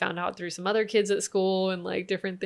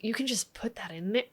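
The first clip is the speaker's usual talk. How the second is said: put-on whispered, curious child question; son's hushed question; 2 semitones higher, 7 dB quieter than usual. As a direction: breathe it like a wide-eyed kid. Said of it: "he was like".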